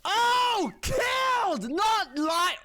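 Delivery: high, loud correcting herself; loud high indignant correction; mock correction shout. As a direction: shout the right word back. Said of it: "I said".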